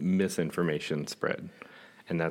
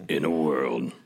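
deep booming movie trailer narrator; 3 semitones lower, 5 dB louder than usual. Pretend. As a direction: announce it like an epic film trailer. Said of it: "use your trailer voice".

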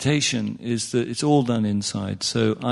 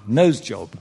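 ordinary talk, nothing marked

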